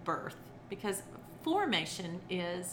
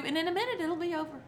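usual speaking voice

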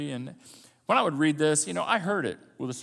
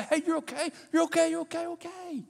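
soothing grandfather; 11 semitones higher than usual. Shown as a demonstration. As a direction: hush a scared child gently. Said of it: "a loving father or a grandfather to say".

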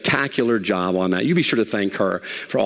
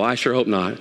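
ordinary talk, nothing marked

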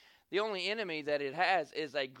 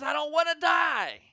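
frightened woman, loud and higher, falling hard on die; panicked cry; 8 semitones higher, 6 dB louder than usual. Retold or imitated. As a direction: cry it out like you're sure the plane goes down. Terrified woman on shaking plane.

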